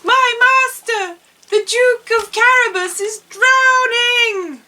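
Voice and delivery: high, loud mock shout for help; cry for help. A narrator's reading. Puss cries out to the king for help, high and loud. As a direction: call out in alarm for help. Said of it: "cried Puss in Boots".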